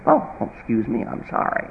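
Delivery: soft, low, near-whispered sorry; meek apology. Retold or imitated. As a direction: shrink back, almost whisper. Parent meekly apologizes.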